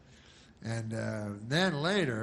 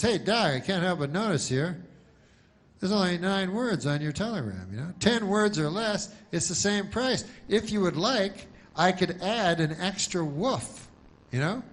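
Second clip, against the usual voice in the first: lightly voiced, higher-pitched clerk; slightly raised pitch, explaining politely to a customer; telegram clerk; 6 semitones higher than usual. Telegram guy explaining the word price to a dog, lightly acted helpful clerk. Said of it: "he says to the dog".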